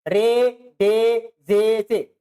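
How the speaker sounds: रे, डे, जे, से drawn out one by one; slow letter drill. Shown as a demonstration. Teacher slowly repeating letters so students can copy. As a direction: give each letter its own slow beat. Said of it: "इसमें कोई फर्क नहीं".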